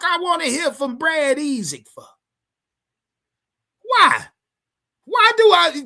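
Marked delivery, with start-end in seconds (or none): 3.8-5.8 exasperated outburst. Real, not acted. Streamer ranting at chat.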